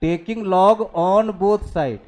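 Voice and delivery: extremely slow, word by word. Shown as a demonstration. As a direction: draw every word out for writing. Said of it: "दोनों side log लो".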